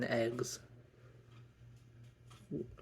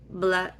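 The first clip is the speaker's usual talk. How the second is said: retching noise; 7 semitones higher, 9 dB louder than usual. Retold or imitated. Imitating throwing up.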